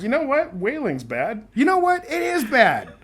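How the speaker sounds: high, hammed-up politician voice; exaggerated lawmakers. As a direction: ham up a self-righteous lawmaker. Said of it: "And so they're like".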